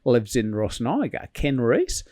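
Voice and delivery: put-on Kiwi old pilot voice; light New Zealand accent, matey. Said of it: "this is a, a New Zealand accent. ... it's really good".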